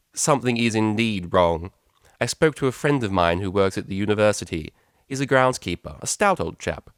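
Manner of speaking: cold, imperious and dismissive; mother cutting short the pleasantries; stern mother. Acted. Mother explaining.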